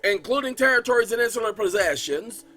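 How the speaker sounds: loud, formal reading of a presidential decree; proclamation read. Acted. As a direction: declaim it like an official proclamation.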